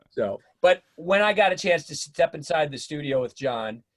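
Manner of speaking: usual speaking voice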